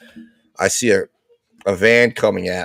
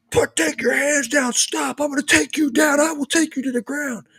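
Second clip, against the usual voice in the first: high, strained imitation of shouted commands; mock screaming officer. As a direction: act out an officer screaming orders, pitched way up. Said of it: "starts screaming at me".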